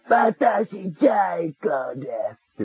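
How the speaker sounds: Jaiko impression. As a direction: belt it out like a bossy cartoon kid sister. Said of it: "って言って".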